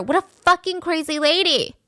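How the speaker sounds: high-pitched mock outraged yell; retold scream. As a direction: act out screaming an insult, held back in volume. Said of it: "he screams at her".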